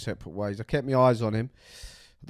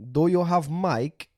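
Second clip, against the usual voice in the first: put-on Russian accent; 6 semitones higher, 3 dB louder, slower than usual. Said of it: "he's Russian".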